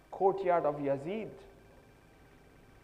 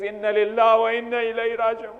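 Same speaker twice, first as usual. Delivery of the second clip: loud, high mourning call; a wailing prayer over the dead; anguished cry; 5 semitones higher, 13 dB louder than usual. Retold or imitated. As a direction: cry it out in grief. Sajjad on Sakina's death.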